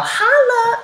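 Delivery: put-on high, loud playful holler to the girls; a hammed-up girlfriend holla; mock holla. Shown as a demonstration. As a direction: shout it like greeting your girls. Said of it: "where you're all".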